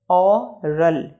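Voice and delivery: ओरल slowly as o, pause, ral; two separate beats on ओरल; broken into syllables. Shown as a demonstration. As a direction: leave a gap inside ओरल. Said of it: "इसको pronunciate कर लेते हैं".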